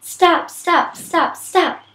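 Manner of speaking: put-on a distraught girl begging it all to stop; Abby's girl voice. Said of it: "I'll try to talk in a girl voice when it's Abby".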